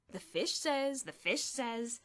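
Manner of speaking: sing-song mocking parrot of his friend; mocking mimicry. A narrator's reading. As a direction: mimic him scornfully, loud. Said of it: "Bruno mimicked".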